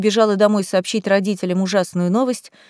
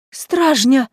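gasp; 4 semitones higher than usual. A narrator's reading. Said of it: "ахнула Бьюти".

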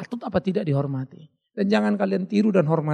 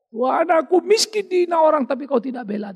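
mock the self-pitying pray-er; whiny complaining prayer; 9 semitones higher than usual. Wrong kind of prayer, a believer accusing God, high whiny complaint. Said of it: "Jangan pernah berdoa katakan".

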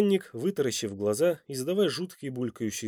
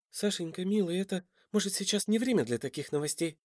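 high, soft, pleading woman; plaintive; 7 semitones higher, 5 dB quieter than usual. A narrator's reading. Mother puts off her child's question, plaintive and soft. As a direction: plead gently in a raised, small voice. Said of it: "Жалобно посмотрела она".

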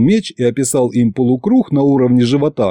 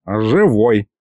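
hoarse croak. A narrator's reading. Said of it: "прохрипел Аристофан".

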